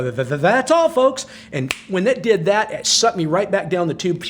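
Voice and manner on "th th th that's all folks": stuttering cartoon pig, rising high on folks; Porky Pig impression. Quoting the Looney Tunes sign-off, stuttering cartoon pig voice. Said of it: "that face would come out and go".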